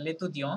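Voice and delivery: লেতুদিয়ঁ ending on a nasal vowel, T dropped; silent final T. Shown as a demonstration. Teacher explains the third word to students, final T silent. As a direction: say লেতুদিয়ঁ without sounding the T. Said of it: "এটা টিটা silent থাকছে".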